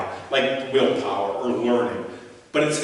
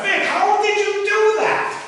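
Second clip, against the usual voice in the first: put-on high, slow, wide-eyed little boy asking; eager kid voice; 5 dB louder, slower than usual. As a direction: play the amazed ten-year-old begging for the trick. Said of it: "And I said to him".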